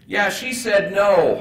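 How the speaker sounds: high, loud and slow; sheepish confession. Retold or imitated. Gabriel admitting his parole officer said no, high and slow. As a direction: drag out the bad news sheepishly.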